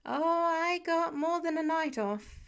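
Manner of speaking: put-on grumbling like a grounded kid; sulky teenager complaining; pouty. A narrator's reading. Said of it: "tone dangerously close to pouty".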